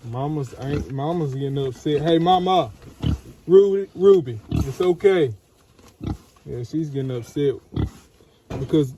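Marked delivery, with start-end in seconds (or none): 2.0-5.3 louder, higher call to the mother. Real, not acted. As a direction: call across the pen to soothe Ruby. Calling to the upset mother.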